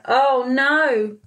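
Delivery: usual speaking voice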